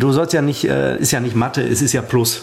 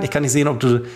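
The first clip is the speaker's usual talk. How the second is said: normal talking voice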